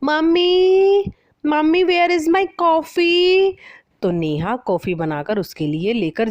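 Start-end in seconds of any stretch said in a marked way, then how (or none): 0.0-3.5 much higher, louder, slow drawn-out call; calling out from her room. Acted. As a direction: yell for mom from another room. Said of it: "आवाज़ लगाती है".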